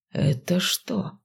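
slow, hushed disbelief; stunned. Acted. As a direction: barely get the words out. Stunned by dead rat.

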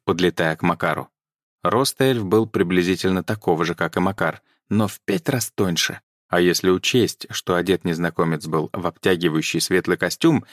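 usual speaking voice

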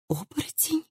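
high, very soft horrified whisper. A narrator's reading. Daughter hears of a werewolf, terrified whisper. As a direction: breathe the question out in fear, very quietly. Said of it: "прошептала Хильди".

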